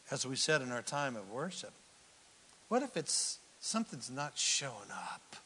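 normal talking voice

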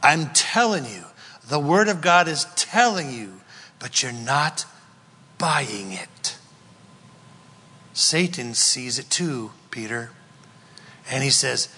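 usual speaking voice

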